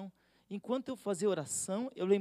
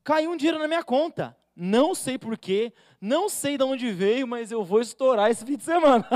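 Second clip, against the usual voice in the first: loud, delighted cry over surprise money; thrilled believer shouting about a lucky payday; excited windfall joy; 4 semitones higher, 10 dB louder than usual. Acted. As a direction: celebrate the unexpected cash out loud. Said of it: "Ele fala assim".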